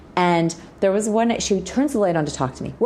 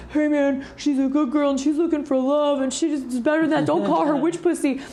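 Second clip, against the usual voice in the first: higher-voiced friend protesting; imitating a good friend telling the guy off, pitched higher; Brent defending her; 9 semitones higher than usual. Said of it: "And Brent says".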